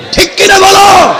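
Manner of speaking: high, loud call for a response; shouted crowd prompt. Real, not acted. Preacher to crowd, demanding they agree, shouted. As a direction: shout it out to the congregation.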